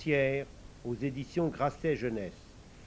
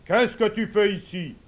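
angry king; 6 semitones higher, 7 dB louder than usual. Acted. King scolds the idiot.

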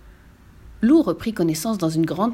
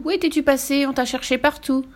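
high-pitched mild scolding; exclaiming; 6 semitones higher than usual. A narrator's reading. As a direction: question the one who went missing. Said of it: "s'écria Maître Hibou".

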